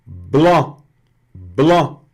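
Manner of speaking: "Bló" nasal, no final C; French blanc. Shown as a demonstration. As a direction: say "bló" with nasal vowel, silent c. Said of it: "não pronuncia o C". (bló nasal sem o C)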